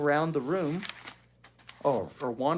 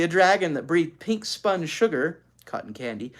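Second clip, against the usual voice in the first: ordinary delivery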